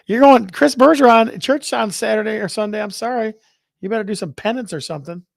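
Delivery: high, loud mock scold about church and penance; hammed-up lecture from a pious relative; mock scolding churchgoer voice. Teasing co-host with a mock scolding about church. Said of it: "John's over here laughing at you talking like that".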